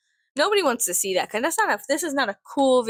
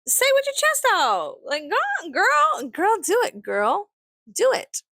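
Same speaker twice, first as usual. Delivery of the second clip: much higher cheerleader squeals; sarcastic hype; 9 semitones higher, slower than usual. Acted. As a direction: cheer them on like a mock hype girl. Hyping trolls on sarcastically.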